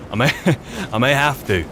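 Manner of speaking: laughing through the words. Real, not acted. Player stuck climbing, laughing mid-sentence. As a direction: giggle at the hassle.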